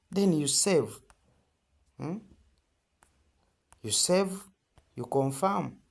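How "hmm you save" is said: normal talking voice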